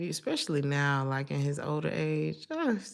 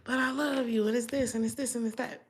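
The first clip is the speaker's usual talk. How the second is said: imitating partner; 7 semitones higher than usual. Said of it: "the type of person who's like".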